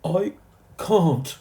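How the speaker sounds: high, loud wail; crying out. A narrator's reading. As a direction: cry down from the tail. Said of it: "cried Timothy".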